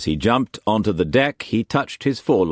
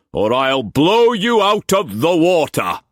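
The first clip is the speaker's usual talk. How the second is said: high, loud hail across the water; shouting; 11 semitones higher, 5 dB louder than usual. A narrator's reading. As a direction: bellow the order across to the junk. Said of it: "he shouted".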